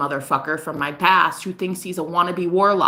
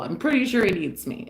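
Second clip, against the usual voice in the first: ordinary talk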